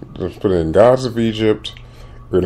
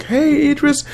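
very high-pitched mock reporter voice; imitated lady interviewer; 14 semitones higher, 4 dB quieter than usual. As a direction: put on a high, chirpy red-carpet interviewer. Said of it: "this lady walked up and interview".

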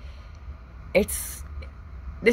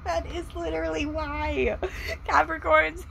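laughing; 13 semitones higher than usual. Real, not acted. Laughing aftermath.

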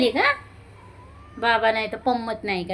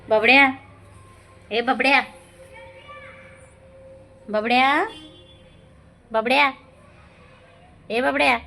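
coaxing call, a little raised; real, lightly raised name-calling; calling a pet; 3 semitones higher, 3 dB louder than usual. Real, not acted.

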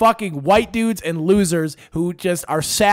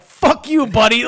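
high, loud mock-aggressive comeback; imitated angry retort; 5 semitones higher, 5 dB louder, slower than usual. Would-be gangster rebuffing peacemaker, imitated angry outburst. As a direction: fire it back like a street brawler. Retold street quarrel.

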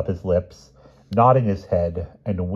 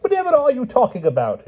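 very high exasperated cry; bewildered king; 7 dB louder than usual. A narrator's reading. Pellinore baffled by the knights' game, high wail. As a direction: wail it in helpless confusion. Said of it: "put his head in his hands".

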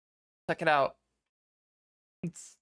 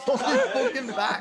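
laughing imitation; 4 semitones higher than usual. Streamer repeats clip line laughing.

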